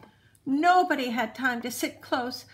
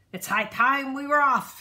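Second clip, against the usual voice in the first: loud captain's announcement; announcing; 3 semitones higher, 6 dB louder than usual. A narrator's reading. Braidbeard announcing departure to his crew, loud call. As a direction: call it out to the whole ship. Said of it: "he announced".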